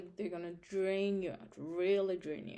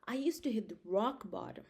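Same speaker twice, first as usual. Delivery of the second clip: ordinary talk, nothing marked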